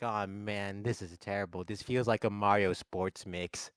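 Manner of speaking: low-pitched, mock-dejected hero; Luigi. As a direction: lament it low in a put-on voice. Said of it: "And Luigi's like".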